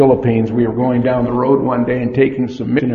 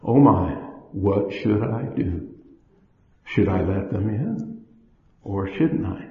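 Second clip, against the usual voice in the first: hushed, much softer, unsure; quiet torn inner voice; 4 semitones lower, 6 dB quieter than usual. Retold or imitated. Woman at the door, torn, murmuring to herself. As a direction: mull it over under your breath. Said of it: "you stand in the middle".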